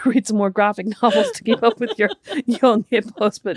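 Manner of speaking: giggling through the words; laughing. Real, not acted. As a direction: keep breaking into laughter. Teasing, cracking up.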